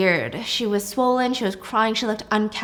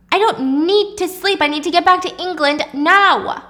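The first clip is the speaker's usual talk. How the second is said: high, loud refusal; angry insistence; 7 semitones higher, 4 dB louder than usual. A narrator's reading. Wife refuses husband's offer of rest, angry. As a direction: push back forcefully, raised voice.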